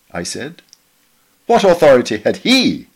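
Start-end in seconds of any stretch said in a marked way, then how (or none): 1.5-2.9 higher and louder, pointed indignant challenge; challenging question. Retold or imitated. Eric challenging the author's choice of Bible, indignant raised question. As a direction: push back hard, questioning the king's right. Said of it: "asked Eric".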